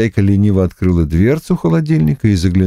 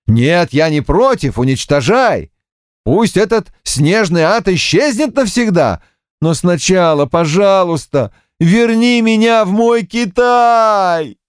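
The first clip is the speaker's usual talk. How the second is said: dramatic pleading; 10 semitones higher than usual. Acted. Monkey king pleads dramatically.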